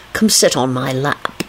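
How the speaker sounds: slow, coaxing plea; unhurried soft coaxing; pleading. A narrator's reading. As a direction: plead gently and slowly. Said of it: "he pleaded".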